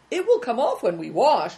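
usual speaking voice

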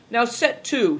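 usual speaking voice

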